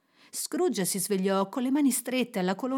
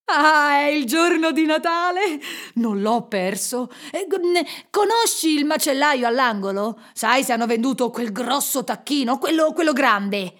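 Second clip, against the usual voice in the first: loud, elated and tumbling; excited calling; 4 semitones higher, 6 dB louder than usual. A narrator's reading. Scrooge asks the boy about the turkey, overjoyed. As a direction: stumble over words with delight.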